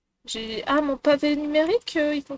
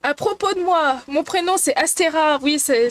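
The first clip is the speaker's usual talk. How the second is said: usual speaking voice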